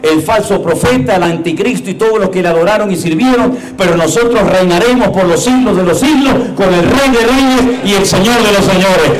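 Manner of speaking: usual speaking voice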